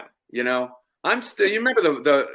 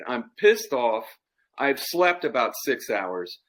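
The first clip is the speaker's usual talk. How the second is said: normal talking voice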